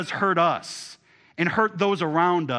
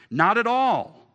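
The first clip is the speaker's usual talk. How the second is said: emphatic; 7 semitones higher, 7 dB louder, slower than usual. Acted. Emphatic denial in reading.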